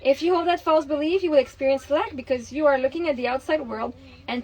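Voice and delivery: steady, earnest book reading; reading aloud. Acted. Mother reading The Secret aloud to her partner, earnest reading. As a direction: read the passage out like a lesson.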